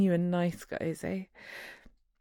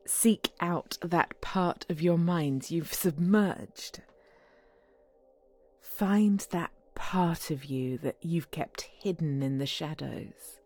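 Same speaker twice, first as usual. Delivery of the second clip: slow, low hypnotist voice; hypnotic voice; 3 semitones lower, slower than usual. Acted. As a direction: lull him into a trance, gently. Hypnotizing partner with phone app.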